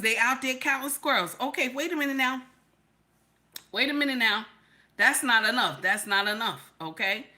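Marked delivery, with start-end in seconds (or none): none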